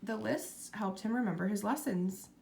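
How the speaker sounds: ordinary delivery, nothing marked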